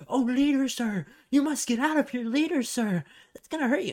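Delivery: hammed-up servile panic; panicky old man. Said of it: "is like".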